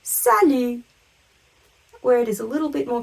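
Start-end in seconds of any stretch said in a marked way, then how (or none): 0.0-0.8 French "salut" with no T at the end; emphatic teacherly "salut", T dropped; silent final T. Shown as a demonstration. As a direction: model "salut" clearly, ending on the vowel. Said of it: "T on the end is completely silent".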